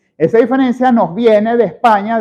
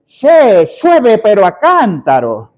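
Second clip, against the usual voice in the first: put-on Argentine accent; 3 semitones higher, 4 dB louder, slower than usual. Teacher quoting an Argentine about rain, in Argentine accent. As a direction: exclaim it like an Argentine, "shueve". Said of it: "cuando un argentino dice".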